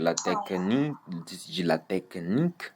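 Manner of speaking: "technique" split in the middle, softly; soft "technique" with a small break inside it; pause au milieu. Shown as a demonstration. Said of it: "tu mets une petite pause au milieu". (« technique » coupé au milieu, doucement)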